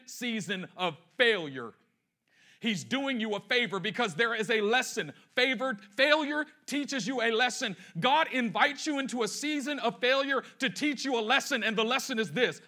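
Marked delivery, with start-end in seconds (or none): none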